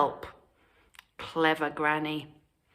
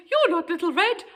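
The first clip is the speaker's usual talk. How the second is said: alarmed granny; 11 semitones higher, faster than usual. A narrator's reading.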